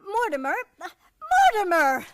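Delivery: indignant. Acted. Indignant fiancée calling.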